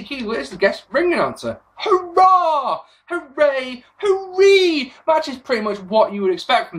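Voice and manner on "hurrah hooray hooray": mock cheer. Sarcastic cheer at guest referee.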